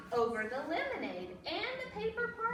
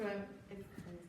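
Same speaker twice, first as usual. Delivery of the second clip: ordinary delivery, nothing marked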